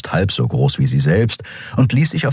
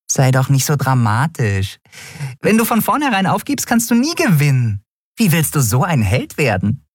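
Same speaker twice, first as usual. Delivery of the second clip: high-pitched little coach, gently scolding; chiding Nelen; 9 semitones higher than usual. Acted. Nelen tells Miko not to give up, high and chiding. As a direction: urge him on in a high, small voice.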